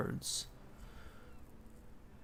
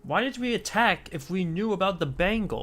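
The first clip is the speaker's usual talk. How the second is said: exasperated; 5 semitones higher, 6 dB louder than usual. Real, not acted. Frustrated about game plot.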